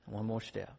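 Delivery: soft, tired murmur to yourself; gasping encouragement on a steep climb; weary self-coaxing. Retold or imitated. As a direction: coax yourself up the hill quietly. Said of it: "all you can do is say".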